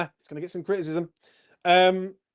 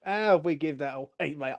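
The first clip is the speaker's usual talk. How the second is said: normal talking voice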